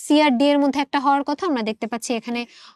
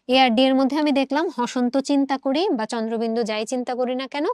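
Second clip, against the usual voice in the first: normal talking voice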